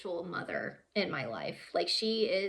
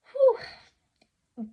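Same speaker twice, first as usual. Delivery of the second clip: sharp, high whew; heavy exhale; 8 dB louder than usual. Real, not acted. Woman pausing after saying it wasn't fair, heavy exhale. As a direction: blow out a loud breath of emotion.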